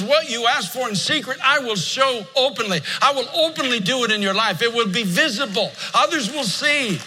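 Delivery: pitched high, building preacher cry; fervent preaching. Acted. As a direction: preach it up toward the climax. Preaching climax.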